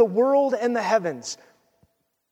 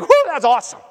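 excited cheer; 5 semitones higher, 5 dB louder than usual. Pretend. Cheering on God's will.

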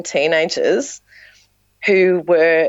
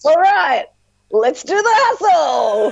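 high, loud call to a crowd, drawn out at the end; rally the crowd in a mock show-host shout; hammed-up stage cheer; 11 semitones higher, 3 dB louder, slower than usual. Performer rallying drunk crowd despite threats, hammed-up cheer.

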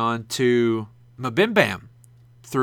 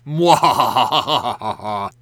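slow, drawn-out evil cackle; evil villain laugh; 2 semitones lower, 3 dB louder, much slower than usual. Pretend. Gloating at Jake over clip show.

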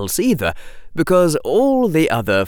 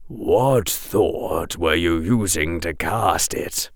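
low, slow kind teacher; a soft professor's question; gentle teacher voice; 5 semitones lower, 4 dB quieter, slower than usual. A narrator's reading.

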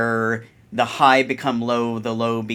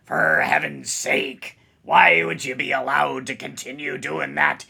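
pirate voice. Pretend. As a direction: ham up a salty pirate scolding. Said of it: "Talk like a pirate day".

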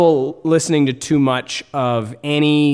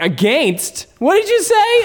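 against? jumping sharply up in confused disbelief; baffled and much higher, almost squeaking; puzzled surprise; 4 dB louder, slower than usual. Retold or imitated. American listener baffled by a Canadian word, high incredulous surprise.

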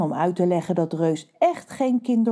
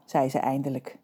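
usual speaking voice

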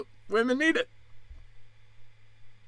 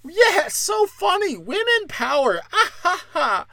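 much higher, braying fake laughter; mock cackling heckler; 13 semitones higher than usual. Women mocking the heckler's shrill cackling. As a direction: cackle like an obnoxious drunk fan. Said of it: "And you're over here".

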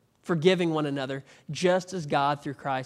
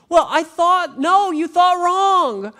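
mock back-and-forth; 12 semitones higher, 10 dB louder, slower than usual. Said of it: "And I said".

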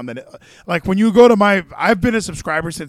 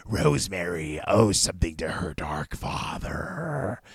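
a lightly voiced, lower, slower sinister preacher; Sinister Minister impression; 8 semitones lower, 5 dB quieter, slower than usual. Host trying out a wrestling manager's voice for his co-host, low and slow. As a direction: drop into a slow, ominous minister voice. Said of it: "Is that a good Sinister Minister?".

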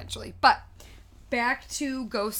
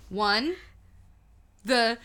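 ordinary talk

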